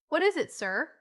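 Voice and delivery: puzzled, rising question; wondering. A narrator's reading. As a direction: ask in wonder. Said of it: "again asked Missus Pepper wonderingly".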